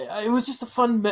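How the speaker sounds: normal talking voice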